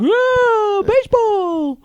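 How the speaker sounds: put-on high, loud drunken whoop, drawn out; shit-faced fan. Co-host imagining the drunk man at the ballgame, shit-faced whoop. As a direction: holler it like a plastered spectator. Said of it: "He was probably shit-faced".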